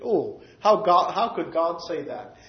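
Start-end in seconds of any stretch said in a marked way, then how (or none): none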